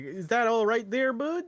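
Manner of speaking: high, friendly Canadian bud voice; suburban Canadian accent. Retold or imitated. Mimicking a Canadian suburbs guy for a friend, high and folksy in accent. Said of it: "that sort of Canadian accent of ... the deep suburbs kind of guy".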